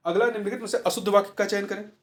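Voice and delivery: ordinary delivery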